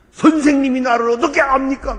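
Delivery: loud, high defiant challenge; defiant retort. Retold or imitated. Troubled student defying his teacher, loud retort. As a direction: throw it back at the teacher. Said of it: "말끝마다 하는 얘기가".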